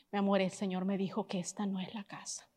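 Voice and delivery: very soft, low, steady; quiet repeat. Retold or imitated. Husband gently repeating to his wife, very soft.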